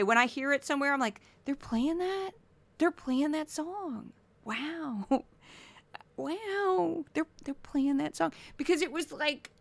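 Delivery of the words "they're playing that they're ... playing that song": higher-pitched, drawn-out wonder; acted disbelief at what's playing; mock astonishment. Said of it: "Wow, when I hear it today".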